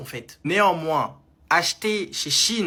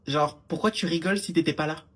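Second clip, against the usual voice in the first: usual speaking voice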